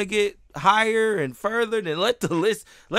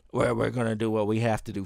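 mock threat; 10 semitones lower, 8 dB quieter than usual. Quoting the threat again.

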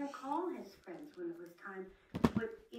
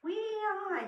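high, loud call to friends; 11 semitones higher, 7 dB louder than usual. Acted. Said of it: "he called".

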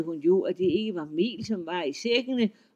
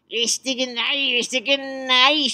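flustered troll; 5 semitones higher, 7 dB louder, slower than usual. Acted. Troll forcing denial.

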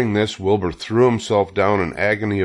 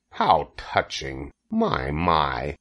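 low, slow sarcasm; mocking; 4 semitones lower, 4 dB quieter, much slower than usual. A narrator's reading. Rat scoffs at Wilbur's heartbreak, mocking. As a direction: draw it out in low mock sympathy. Rat mocks the pig's plea.

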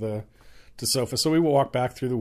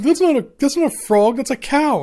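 higher-pitched, mock insistence; insistent; 11 semitones higher, 4 dB louder than usual. Friend insisting she hears a cow, mock insistent. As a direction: argue back in a raised pitch. Acted argument over cow or frog.

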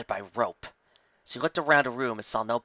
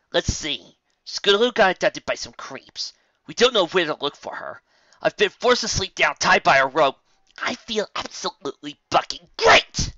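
higher, louder bitter sarcasm; sarcastic; 7 semitones higher, 7 dB louder than usual. Acted. Rainbow answers Applejack with loud bitter sarcasm. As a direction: spit out the list, then fake cheer. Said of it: "she answered sarcastically".

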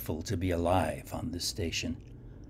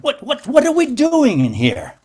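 much higher and louder, stammering in panic; frantic loud protest; 12 dB louder than usual. A narrator's reading. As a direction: shout the stammered question over the crowd. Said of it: "I protested".

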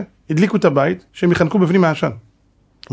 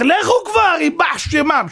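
much higher, drawn-out impatient cry; mock outburst of a man at his limit; acted exasperation; 11 semitones higher, slower than usual. Hiding father as they keep looting, acted exasperated cry.

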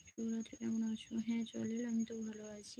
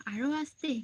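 higher and louder, half-amused disbelief; surprised question; 4 semitones higher, 6 dB louder than usual. Real, not acted. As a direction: echo the request in disbelief. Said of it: "আরো আস্তে বলো, কান ফেটে যাবে".

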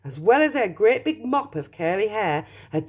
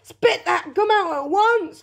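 raised, higher-pitched command; stern order; 13 semitones higher, 3 dB louder than usual. A narrator's reading. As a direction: order her like an alarmed father. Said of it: "ordered Mister Beauregard".

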